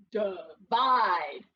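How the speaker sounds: "divide" slowly with a schwa first, as duh-VIDE. Shown as a demonstration. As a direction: stretch "divide" as duh, then a stressed VIDE. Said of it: "Breve or schwa?".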